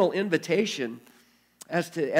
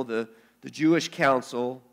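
usual speaking voice

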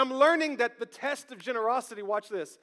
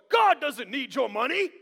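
preacher quote; 2 semitones higher, 4 dB louder than usual. Retold or imitated. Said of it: "preachers go like".

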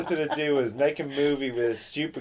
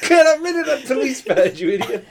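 loud, high comic telling-off; exasperated mock yell; mock scolding shout; 11 semitones higher, 8 dB louder than usual. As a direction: order a bungling accomplice about, half laughing. Fake movie man scolds accomplice, imagined.

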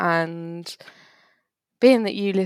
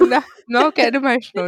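quick, louder, laughing through it; laughing denial; 5 semitones higher, 6 dB louder, faster than usual. Real, not acted. Denying to co-host she's emotional, laughing. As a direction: brush off the tease with a laugh.

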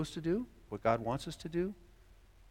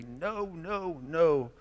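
mock stubborn refusal; 3 semitones higher, much slower than usual. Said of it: "when we stomp our feet".

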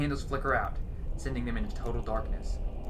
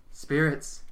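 louder second call to the spirits; calling again; 4 semitones higher, 7 dB louder than usual. A narrator's reading. Medium calls the spirits once more, louder. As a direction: raise the voice to summon. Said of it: "Hazel continues".

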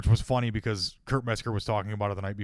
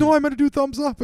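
mock kid whine; 10 dB louder than usual.

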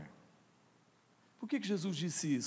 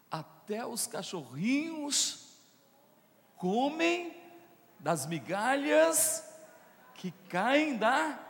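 loud, higher preaching build; preaching at full force; 10 semitones higher, 5 dB louder, much slower than usual. Acted. Pastor fires up the congregation, loud rising preaching. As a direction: drive the sermon upward with urgency.

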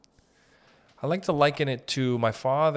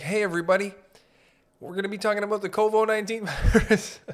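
high, chirpy, louder mock announcement; building manager; 8 semitones higher, 4 dB louder, faster than usual. Building manager opening the tenant meeting, high and bright. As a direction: chirp it like a bubbly meeting host. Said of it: "imagine being the lady who puts together".

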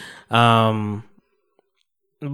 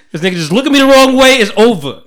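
higher, louder mock rant; mock threat; 9 semitones higher, 6 dB louder than usual. Ranting about a coworker, in a loud, high mock threat. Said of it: "one more time".

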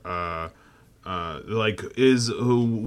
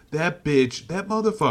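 outraged yell; 7 semitones higher than usual. Real, not acted. Heated rant about Anakin's age.

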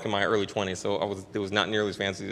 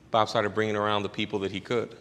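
normal talking voice

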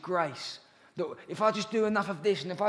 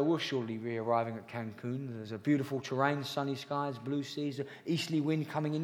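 low smooth captain's announcement; pilot voice; 5 semitones lower than usual. Retold or imitated. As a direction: do the airline captain describing the view. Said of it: "They go".

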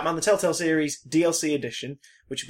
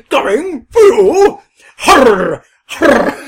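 mock dramatic; 7 semitones higher, 14 dB louder, much slower than usual. Acting out horror.

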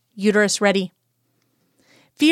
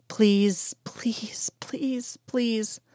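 breathless whispered pleading, much softer; desperate whisper; 7 dB quieter, much slower than usual. Acted. Scully silently begging during the insemination, whispered. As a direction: beg under your breath, barely voiced.

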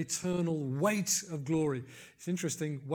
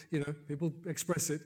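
ordinary talk

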